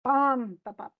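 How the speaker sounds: BUM, bum, bum, first beat long and strong; rhythm demonstration. Shown as a demonstration. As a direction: beat out one strong syllable and two quick weak ones. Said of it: "So it's just like".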